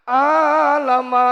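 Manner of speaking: "Alama" chanted loud with very long vowels; misread letters. Acted. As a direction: draw out "Alama" slowly as a botched recitation. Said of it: "bacanya alama". ("Alama" dibaca panjang dan lantang)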